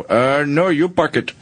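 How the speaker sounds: put-on lightly done Indian accent valet; a hint of Indian accent. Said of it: "like an Indian accent".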